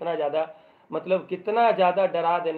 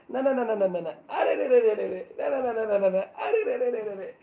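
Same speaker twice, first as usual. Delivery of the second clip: higher, half-laughing "no no no", hammed-up fright; mock panic; 4 semitones higher than usual. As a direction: play the doctor squirming away from the snake. Doctor panicking at the snake. (डरे हुए doctor का मज़ाकिया, ऊँचे सुर में "ना ना" और "अरे रे")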